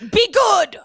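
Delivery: high, loud "be good" yelled at the screen; mock command shout. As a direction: bark it out like scolding a dog, high and loud. Reacting to the episode scene.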